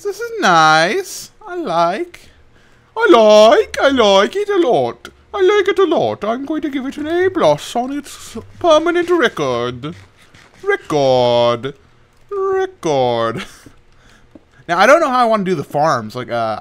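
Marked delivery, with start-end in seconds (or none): 0.0-1.3 much higher, slow stretched-out squeal; high silly voice. Pretend. High cartoon squeal praising his new room. Repeats in put-on voice. 1.5-6.2 much higher, louder cartoon voice; high silly voice. High cartoon gush about his room. 6.2-13.4 much higher playful schoolteacher tone; high mock teacher voice. High mock teacher grading his room.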